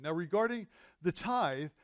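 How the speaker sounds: ordinary talk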